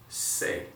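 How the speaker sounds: "se" said softly as the Spanish letter name. Shown as a demonstration. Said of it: "the C is gonna sound like this".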